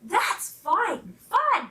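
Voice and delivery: high, drawn-out rabbit glee; tickled rabbit, savoring the fun; delighted cry. A narrator's reading. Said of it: "he cried".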